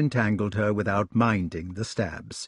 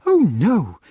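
lady's protest; 7 semitones higher, 6 dB louder than usual. Acted. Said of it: "said the gentlewoman".

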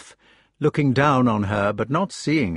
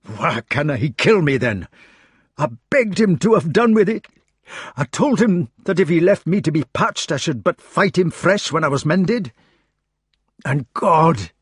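Scottish burr, higher and louder in wounded anguish; anguished Scots Gawain; 6 semitones higher, 4 dB louder than usual. Acted. Gawain crying out to Arthur about Lancelot in a Scottish burr. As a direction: rage at being spared in a Scots voice.

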